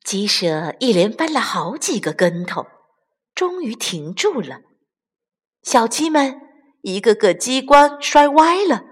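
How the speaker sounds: ordinary delivery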